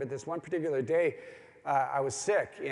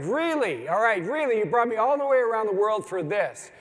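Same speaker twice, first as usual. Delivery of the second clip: higher, louder exasperated whine; whining to God; 5 semitones higher, 7 dB louder than usual. Retold or imitated. Sick pilgrim whining to God about his bad day, loud exasperated. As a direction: complain like a fed-up traveller. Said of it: "I was whining".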